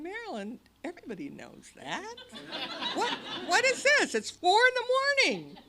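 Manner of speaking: high, loud laughing disbelief; a chuckling mock of Tom; amused neighbor. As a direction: laugh it off, half asleep. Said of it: "And he's saying".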